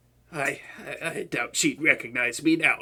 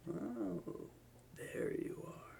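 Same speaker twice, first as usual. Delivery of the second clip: hushed villain whisper, barely voiced; sneaky whisper; 14 dB quieter than usual. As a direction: whisper it, creeping up on someone.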